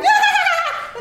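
high laughing shriek; mock shriek. Sister in the back squealing, high laughing shriek. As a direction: let out a gleeful squeal. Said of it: "my sister started going".

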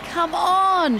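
high, excited call of encouragement; roaring. A narrator's reading. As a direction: cheer the team on from the stands. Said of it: "she roared".

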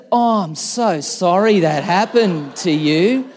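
high, loud sugary concern; imagined sweet wife comforting him, hammed-up tender; mock sympathy. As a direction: ham up the doting wife you wished for. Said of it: "Like, where's the".

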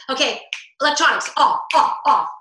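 loud, clipped commands; barking orders. Shown as a demonstration. Mother ordering kids off electronics, loud impatient commands. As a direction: bark it at the kids. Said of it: "As opposed to".

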